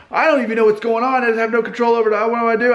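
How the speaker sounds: high, loud, rushed mock panic; acted frantic meltdown, words tumbling out; chaotic. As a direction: spiral into helpless hysteria, hammed up. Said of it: "or it could even be chaotic".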